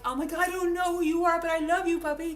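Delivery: mock-excited puppy love; excited gush. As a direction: burst out with delight at the dog. Greeting a dog on the street.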